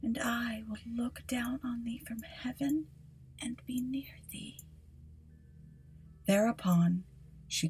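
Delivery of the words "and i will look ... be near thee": faint breathy whisper of a dying mother; barely voiced, fading last words.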